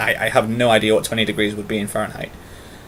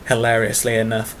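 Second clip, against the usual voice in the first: ordinary talk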